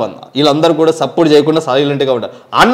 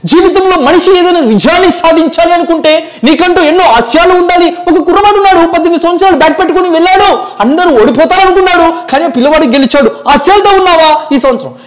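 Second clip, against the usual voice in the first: much higher, louder, fast preachy voice; mimicked motivational speaker; 10 semitones higher, 3 dB louder, faster than usual. Mimic inspires the audience in a much higher, louder voice. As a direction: fire up the crowd like a motivational speaker.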